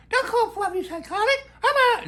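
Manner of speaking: hammed-up high voice of the neighbour taking offence for Fluffy; offended dog lady. Woman offended for her dog.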